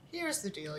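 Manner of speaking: ordinary talk, nothing marked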